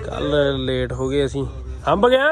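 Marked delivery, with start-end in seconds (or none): 1.9-2.3 calling out. Real, not acted. Calling out over machine.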